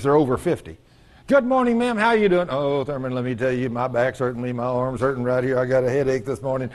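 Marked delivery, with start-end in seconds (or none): 1.3-2.5 high, chirpy acted hello; cheery greeting. Preacher greeting an older woman at church, high and cheery. 2.5-6.7 low, droning litany of aches; complaining churchgoer. Acted. Older woman telling the preacher her ailments, droning complaint. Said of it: "do you hear people talk like that?".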